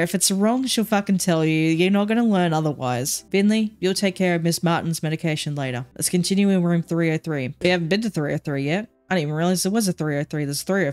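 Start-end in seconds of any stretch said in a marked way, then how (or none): none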